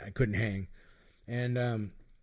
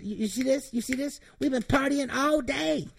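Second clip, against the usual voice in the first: much higher, louder put-on woman's voice; Diamond's voice; 14 semitones higher, 4 dB louder than usual. Imitating Diamond in the tent, high loud exasperated voice. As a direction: play her shrill-free but high and loud. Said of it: "She said".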